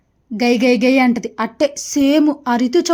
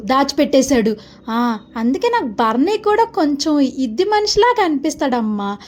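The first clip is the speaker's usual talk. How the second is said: ordinary talk, nothing marked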